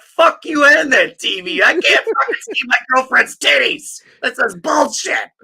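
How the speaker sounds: high, loud whiny screech of complaint; shrieking Damien. Pretend. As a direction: screech it like a furious prisoner. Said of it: "Davey Nichols would be like".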